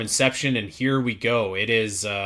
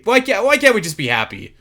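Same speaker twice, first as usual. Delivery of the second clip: high-pitched exasperated plea; pleading with fellow fans, voice pitched up; 8 semitones higher, 3 dB louder than usual. Retold or imitated. Pleading with bothered Metroid fans, exasperated and high.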